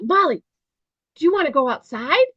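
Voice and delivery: high, bright, fast call to the dog; cheerful dog-owner voice, upbeat and quick; happy sing-song to a dog. Shown as a demonstration. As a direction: sound like a treat is coming. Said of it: "That intonation and inflection is so important".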